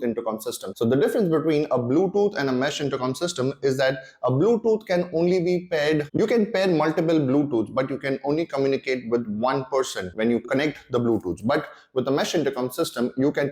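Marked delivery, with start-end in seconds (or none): none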